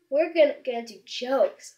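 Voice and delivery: ordinary talk, nothing marked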